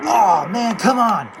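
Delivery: loud, exasperated groan of words; frustrated shout. Real, not acted. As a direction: yell at the bad play. Frustrated at game play.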